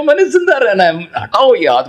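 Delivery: high-pitched mock cry of desperation; acted cry. Suffocating cell demands the hand be removed, high acted cry. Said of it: "ایک ایک خلیہ چیخ کے کہے گا".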